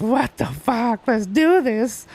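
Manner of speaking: slow, high, drawn-out hype; hyped up. Retold or imitated. Painter pumping himself up Ric Flair style, slow and high. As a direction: stretch it out like a wrestler getting pumped.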